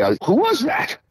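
a baffled manager asking who called; puzzled question. Retold or imitated.

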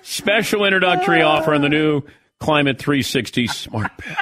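ad read broken by laughter; laughing through read. Real, not acted. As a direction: read it while cracking up.

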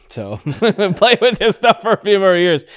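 laughing through the words, slowly; amused laughing, slow. Real, not acted.

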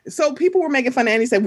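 ordinary talk

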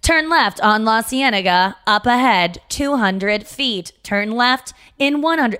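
drawn-out, slow navigation voice; slow GPS voice. Retold or imitated. Mocking the slow Uber GPS, drawn out. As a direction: drag out each direction. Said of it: "it'll be like".

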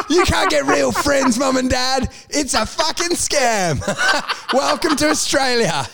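high, slowed, ranting half-laugh; mocking shout. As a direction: jeer at the parents through laughter. Mock shout at his parents.

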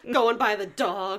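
ordinary talk, nothing marked

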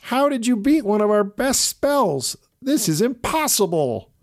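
very high, loud shocked witch voice; shocked witches. A narrator's reading. Witches seeing their spell broken, shocked witch voice. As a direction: sputter in disbelief. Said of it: "The witches said".

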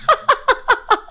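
high helpless laugh; cracking up for real; real laughter. Real, not acted. Comedian breaks into real high laughter at the story. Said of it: "I'm dying".

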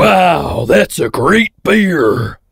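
very slow, gritty Viking-like delivery; pro wrestler. Acted. Actor trying out an American accent for the director, gritty wrestler voice. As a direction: drag it out with too much grit, like a wrestler. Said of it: "like a pro wrestler or a Viking".

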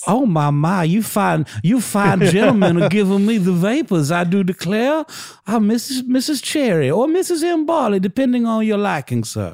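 playful Southern lady swooning at the gentlemen; hammed-up Southern accent, fluttering and coy; Missus Cherry southern belle. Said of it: "Adopting a thick Southern accent".

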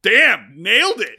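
loud, high burst of praise; nailed it, loud and delighted; excited cheer. Real, not acted. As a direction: cheer the lucky guess. Guess confirmed, cheering.

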